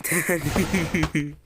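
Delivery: drawn-out word broken up by laughter; real giggling after a fright; laughing. Real, not acted. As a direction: laugh right through the word. Laughing off the scare.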